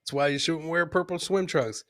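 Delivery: ordinary talk, nothing marked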